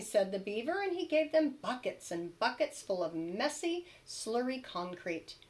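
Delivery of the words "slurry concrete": ordinary delivery, nothing marked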